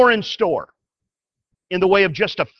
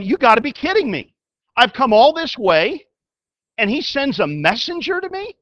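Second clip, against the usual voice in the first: raised, outraged voice of an offended general; indignant Naaman; 5 semitones higher, 4 dB louder than usual. Retold or imitated. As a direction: play the insulted commander, indignant. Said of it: "Naaman's gotta be sitting there going".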